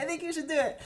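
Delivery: gentler repeated urging. Retold or imitated.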